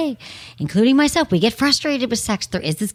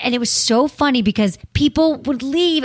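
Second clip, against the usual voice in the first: ordinary talk, nothing marked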